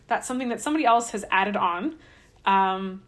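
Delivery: usual speaking voice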